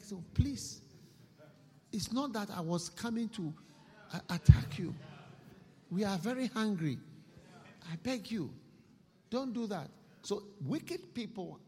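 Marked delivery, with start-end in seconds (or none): 0.3-9.9 pleading thief. Acted. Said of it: "you see how he'll beg".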